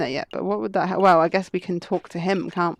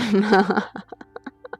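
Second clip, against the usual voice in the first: amused, breaking laugh. Real, not acted. Streamer cracking up at the story, real laugh. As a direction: laugh at your own joke.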